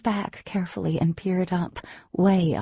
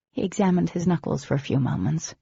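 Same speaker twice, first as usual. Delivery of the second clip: ordinary talk, nothing marked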